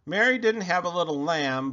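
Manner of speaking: ordinary delivery, nothing marked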